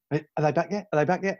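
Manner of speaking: high-pitched, eager pet voice; eager dog. Retold or imitated. Dogs at the door awaiting owners, eager and high. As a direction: imitate an impatient dog at the door. Voicing waiting dogs from film.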